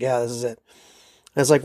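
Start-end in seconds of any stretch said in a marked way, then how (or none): 0.0-0.6 low, softer mock grunt; grunting conductor. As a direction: lightly imitate a gruff, dismissive grunt. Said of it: "he kind of grunts like".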